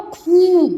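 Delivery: खू with a long held oo; long vowel. Shown as a demonstration. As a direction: stretch खू into khooo. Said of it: "बड़ा ऊ की मात्रा".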